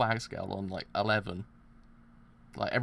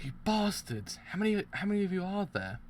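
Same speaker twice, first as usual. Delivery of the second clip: angry yell; 8 semitones higher than usual. Real, not acted. Yelling at enemies in game.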